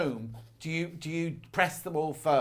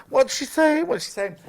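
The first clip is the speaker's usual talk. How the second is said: high, loud mock bewilderment; acted bafflement; 11 semitones higher, 8 dB louder than usual. Presenter acting out not following her producers' talk, mock bafflement.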